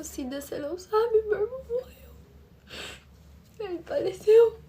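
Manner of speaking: voz de choro aguda e baixa; notícia chorosa. Retold or imitated. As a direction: reviva a notícia com a voz embargada de choro. Conta à Cida a morte do irmão. (contando a morte do irmão, chorosa)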